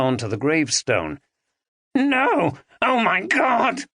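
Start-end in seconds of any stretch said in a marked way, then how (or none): 1.9-3.8 high horrified cry; anguished cry. A narrator's reading. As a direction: Dominic cries out at what he did. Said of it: "cried Dominic".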